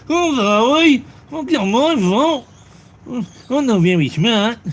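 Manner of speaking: high, put-on simpleton voice; silly high voice, pretending to be sorry; mock apology. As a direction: play dumb with a high-pitched, loud apology.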